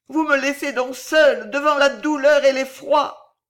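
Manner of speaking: high, plaintive wail; sobbing lament. A narrator's reading. Child on her knees over dead mother, high wailing grief. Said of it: "se prit à sangloter".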